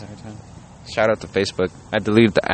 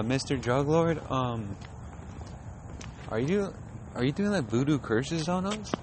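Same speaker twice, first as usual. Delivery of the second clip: higher, soft, hesitant asking; small uneasy voice, pitched up; timid victim; 5 semitones higher, 6 dB quieter than usual. Retold or imitated. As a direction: nervously imitate a timid questioner. Timid victim questions the drug lord.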